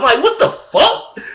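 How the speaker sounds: high drawn-out what the fuck; incredulous. Retold or imitated. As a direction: stretch out the outrage. Recalls teammate's fumble.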